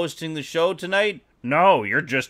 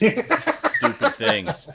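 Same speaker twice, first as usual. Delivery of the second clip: drawn out; 10 semitones higher, much slower than usual. Pretend. Drawn out joke.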